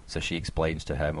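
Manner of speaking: normal talking voice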